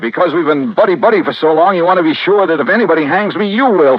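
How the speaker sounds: high, sneering sarcasm, rising in anger; wounded friend turning sarcastic and sharp; bitter sarcasm. Acted. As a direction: throw his suspicion back at him, stung and mocking. Bitter quarrel with the detective.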